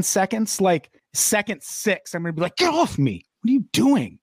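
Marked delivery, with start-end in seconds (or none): 2.6-4.2 acted startled protest, pitched high; indignant rebuff. Woman rebuffing someone touching her shoulder, indignantly. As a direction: push the person away with raised pitch.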